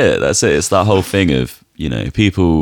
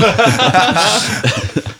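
high laugh. Real, not acted. Laughing at own joke.

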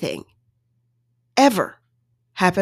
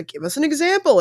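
a raised voice pressing for an example; mock host demanding specifics, higher; mimicking the hosts; 8 semitones higher than usual. Hosts Nick and Vanessa pressing the guest, a raised, demanding voice.

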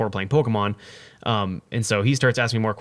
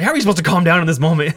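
higher, louder, fast frazzled outburst; frazzled panic; 5 semitones higher, 6 dB louder, faster than usual. Retold or imitated. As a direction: blurt it out in a panic. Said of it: "frazzled, panicked".

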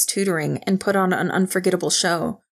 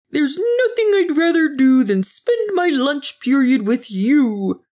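put-on high-pitched kids' show dinosaur; gushing mock sweetness in a high dinosaur voice; purple dinosaur voice; 7 semitones higher, 4 dB louder, slower than usual. A narrator's reading. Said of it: "in his purple dinosaur voice".

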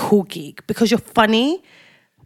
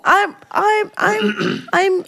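high flustered stammer; 11 semitones higher, much slower than usual. Acted.

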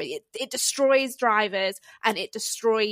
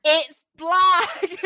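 excited echo; 8 semitones higher, 4 dB louder than usual. Real, not acted. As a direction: squeal it again with joy. Echoing the reveal excitedly.

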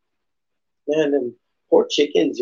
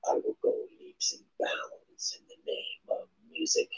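whisper; 15 dB quieter than usual. Real, not acted. Whispered aside about music.

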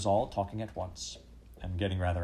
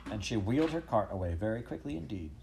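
usual speaking voice

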